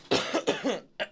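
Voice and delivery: repeated rough throat clears; throat-clearing demonstration. Shown as a demonstration. Host demonstrating a drippy sinus habit, throat clearing. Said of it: "a lot of this".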